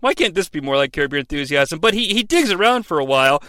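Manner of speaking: ordinary delivery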